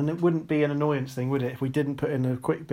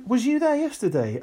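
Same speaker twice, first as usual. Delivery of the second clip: high-pitched imitation of sloppy speakers; lifted voice mocking bad grammar; people's bad grammar; 7 semitones higher than usual. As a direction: mimic someone casually getting the grammar wrong, pitched high. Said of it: "But people go".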